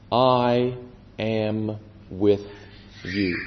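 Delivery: low and soft, spaced word by word; hushed, measured promise with pauses; solemn reassurance. Retold or imitated.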